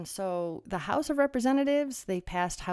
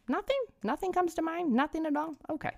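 higher, feigned-puzzled questioning; mock innocent questions; 8 semitones higher, faster than usual. Vlogger baiting viewers with sarcastic feigned-innocent questions. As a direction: ask as if nobody could possibly think of one.

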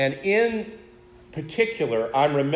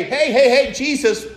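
a startled disciple calling after Jesus, much higher and louder; 6 semitones higher, 4 dB louder than usual. Retold or imitated. Disciples calling after departing Jesus, raised voice. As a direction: call out to Jesus, hey wait a minute, raised and urgent. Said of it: "and to say".

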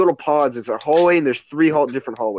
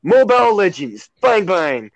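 slow, punchy ad announcer voice; game ad announcer; 6 semitones higher, slower than usual. Retold or imitated. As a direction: hype it like a game trailer. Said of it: "That's what they say in the ads".

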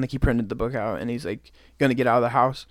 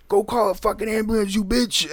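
higher angry mock shout at mom; angry yell; 8 semitones higher than usual. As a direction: snap it at her, furious. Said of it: "He's, like, really angry".

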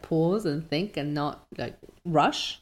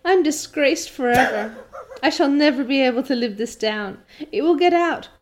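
wailing despair; 6 semitones higher, 3 dB louder than usual. A narrator's reading. Anne despairing, read aloud.

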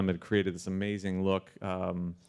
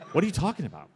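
incredulous; 8 semitones higher, faster than usual. Retold or imitated. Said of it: "I'm like".

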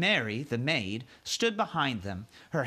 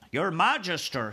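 giant's voice; 5 semitones higher, 3 dB louder than usual. Acted. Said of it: "he said".

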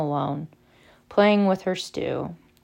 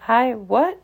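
higher, startled stumble; mildly thrown, voice lifting in confusion; disconcerted; 5 semitones higher, 4 dB louder than usual. A narrator's reading. Harry reacting to Luna's odd claim, disconcerted. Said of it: "said Harry, disconcerted".

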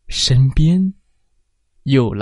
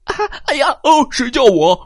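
startled giant; 13 semitones higher, 4 dB louder, faster than usual. Acted.

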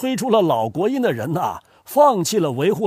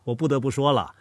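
usual speaking voice

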